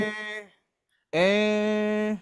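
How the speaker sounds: soft, limp "Eh." with no push; a half-hearted "Eh." a child would ignore; weak reprimand. Retold or imitated. Mother giving a weak, soft warning to her child. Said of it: "berbeda dengan gini".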